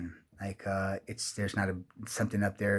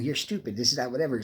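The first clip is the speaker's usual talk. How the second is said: ordinary talk, nothing marked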